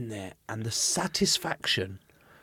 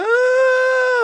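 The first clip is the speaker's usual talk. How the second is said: put-on high, drawn-out toddler wail; long mock bawl, very high; imitated child crying; 10 dB louder than usual. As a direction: wail like a crying two-year-old. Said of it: "And he was crying".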